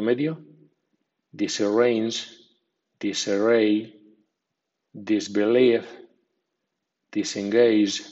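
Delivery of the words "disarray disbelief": usual speaking voice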